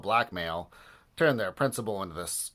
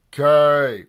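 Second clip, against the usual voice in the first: loud shouted answer; a fan yelling a letter back, loud; crowd shout; 4 semitones higher, 11 dB louder than usual. Acted. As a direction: bellow it back like a stadium crowd. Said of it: "repeated the fans".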